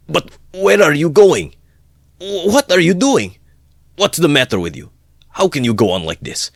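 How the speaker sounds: high, loud, exasperated questions; at wits' end. A narrator's reading. Friend chasing the departing man, at his wits' end. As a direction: plead desperately, starting high and loud, easing down. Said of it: "muttered, at his wits' end".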